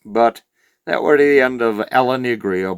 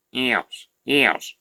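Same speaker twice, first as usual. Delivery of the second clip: quiet, droning yes with no lift; deadpan, bored repetition; monotone Alan; 4 dB quieter than usual. Retold or imitated. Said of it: "It was bloody monotone as shit".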